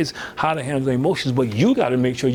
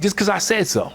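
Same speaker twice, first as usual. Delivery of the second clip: higher-pitched, curt parental retort; mother losing calm; 6 semitones higher than usual. Retold or imitated. Mother retold ending talk with her son, mildly exasperated. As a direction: imitate a mother shutting down questions. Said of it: "she started out real calm".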